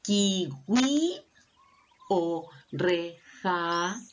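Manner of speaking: slow "Kiwi" and "reja", each ending held; sounded out choices. Shown as a demonstration. Teacher asking which ends in I, drawn-out "Kiwi" and "reja". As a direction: offer "Kiwi" or "reja" in long syllables. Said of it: "¿Cuál terminará con I?".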